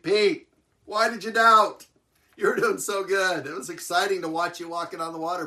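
high, loud, teasing mock disbelief; playful chiding. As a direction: tease a friend with loud, fond exasperation. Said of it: "I think the Lord chuckled".

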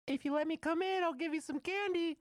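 put-on very high, loud squeaky child voice; comic child falsetto; creepy kid bargaining. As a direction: squeal the offer like a fake little kid. Child coaxing to be let in.

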